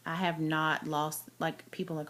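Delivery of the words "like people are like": ordinary delivery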